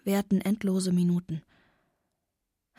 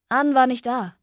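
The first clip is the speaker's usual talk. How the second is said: louder, higher call; 4 semitones higher, 7 dB louder than usual. A narrator's reading. Father calls to his daughter on arriving, calling out. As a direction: call it across to someone. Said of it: "rief er mir zu".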